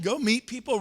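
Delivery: usual speaking voice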